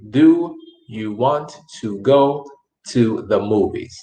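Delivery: "Do you want to go to the movies?" slowly, with a pause before "to the movies"; slow and enunciated. Shown as a demonstration. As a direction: spell it out slowly for learners, leaning on "Do". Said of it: "okay to speak slow and enunciate".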